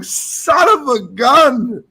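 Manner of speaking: high, slow, drawn-out groan of defeat; mock exasperation. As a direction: stretch it out like a beaten man. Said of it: "I'm like".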